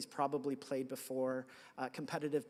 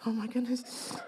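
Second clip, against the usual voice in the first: high, laughing, dragged out; embarrassed laugh; 8 semitones higher, 4 dB louder than usual. Retold or imitated. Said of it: "I was like".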